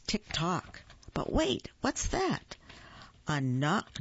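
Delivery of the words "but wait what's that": high startled question; sudden alarm, high; surprised. A narrator's reading. Sudden noise in story.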